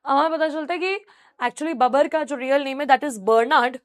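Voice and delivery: normal talking voice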